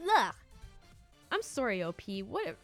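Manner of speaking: ordinary delivery, nothing marked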